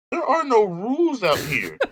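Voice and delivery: laughing it off; amused disbelief with a laugh. Real, not acted. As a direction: laugh through the line. Laughing at the rule complaint.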